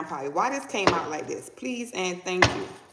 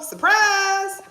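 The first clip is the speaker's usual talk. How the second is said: high, loud exclamation; spring the surprise on them; spirit's surprise shout; 11 semitones higher, 6 dB louder than usual. Acted. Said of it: "spirit say".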